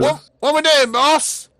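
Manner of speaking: high, loud, excited sidekick; a high eager lackey calling to the boss; eager Jimmy voice. Acted. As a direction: bounce in ready for orders. Player voicing Jimmy.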